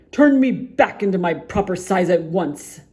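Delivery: loud furious demand; raging sputter. Acted. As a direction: sputter it out in a rage. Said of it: "sputtered Mister Uppity in a rage".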